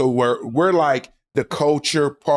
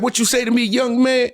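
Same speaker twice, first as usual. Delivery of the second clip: put-on old man challenge; 8 semitones higher than usual. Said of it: "He said".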